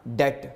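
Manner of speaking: डेट with no b sound; डेट, vowel straight into t; silent b. Shown as a demonstration. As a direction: say debt as डेट, b unspoken. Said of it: "बी की आवाज़ silent हो जाती है".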